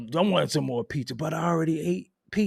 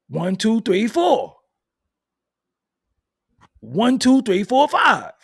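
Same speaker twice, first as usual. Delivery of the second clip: counting bites; 7 semitones higher, 6 dB louder, slower than usual. Shown as a demonstration.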